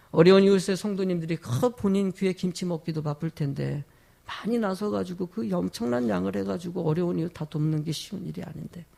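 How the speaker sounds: ordinary talk, nothing marked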